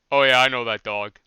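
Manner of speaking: sudden loud blurted reply. Retold or imitated. Mocking Riley's loud reply.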